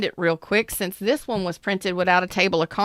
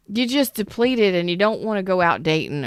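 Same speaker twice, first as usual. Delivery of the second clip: normal talking voice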